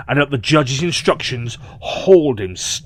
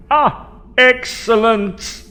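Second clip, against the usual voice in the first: high, slow proclamation; proclaiming; 10 semitones higher, much slower than usual. Acted. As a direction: announce it grandly. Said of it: "he proclaimed".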